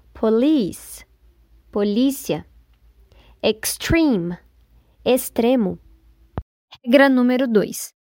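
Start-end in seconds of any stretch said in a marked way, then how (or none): 0.1-1.1 "Police" with -LICE stressed, po- weak; stress on second syllable. Shown as a demonstration. Teacher models loanword "Police", stress on LICE. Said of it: "stress na segunda sílaba". 3.4-4.4 "Extreme" with -TREME stressed, ex- light; stress on second syllable. Shown as a demonstration. Teacher models "Extreme" for learners, stress on TREME. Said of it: "stress na segunda sílaba".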